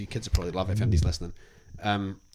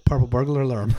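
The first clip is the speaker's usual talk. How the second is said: quicker tongue twister; 2 semitones higher, 5 dB louder than usual. Shown as a demonstration. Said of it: "Do it again".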